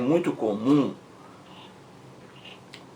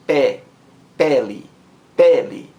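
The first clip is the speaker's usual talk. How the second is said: "pele" with the first syllable punched loud; stress on first syllable; 6 dB louder than usual. Shown as a demonstration. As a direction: isolate "Pé", then hit it hard in "pele". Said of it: "A sílaba mais forte é a primeira".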